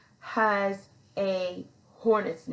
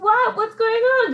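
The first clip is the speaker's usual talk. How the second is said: high, loud startled question; alarmed confusion; 13 semitones higher, 8 dB louder than usual. Retold or imitated. She calls out from under the car to the others, high startled alarm. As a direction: jolt up, suddenly alarmed.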